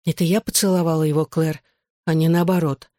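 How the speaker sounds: usual speaking voice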